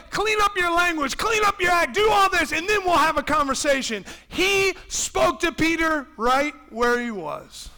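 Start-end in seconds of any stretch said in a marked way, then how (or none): 0.1-4.1 loud, high, lecturing commands; hammed-up preachy order; mock stern demand. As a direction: scold a rough fisherman into shape. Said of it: "He didn't say".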